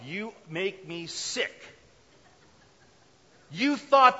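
loud, high, furious king yelling; angry Pharaoh. Pretend. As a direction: throw him out in a rage. Pharaoh throws Abraham out.

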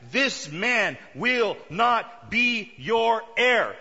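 "this man will not be your heir" slow and loud, each word punched; emphatic. Acted. God correcting Abraham, emphatic on every word of "this man will not be your heir".

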